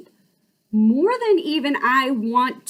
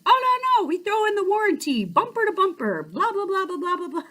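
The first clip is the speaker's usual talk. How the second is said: eager salesman, trailing into laughter; 10 semitones higher, faster than usual. Retold or imitated. Salesman piling on the warranty, higher then fading. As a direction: wave it all off, then laugh it away. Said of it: "He says".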